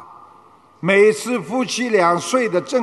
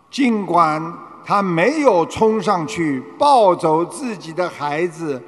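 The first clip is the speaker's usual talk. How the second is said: ordinary delivery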